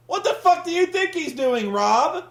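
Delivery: high, loud, incredulous yelling; exasperated rant. Real, not acted. Host yells at the wrestler over the dumb booking, high and loud. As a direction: yell at him in disbelief.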